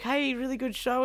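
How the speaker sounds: a hint of a chirpy fan calling out; cheerful fan. Fans greeting fleeing band.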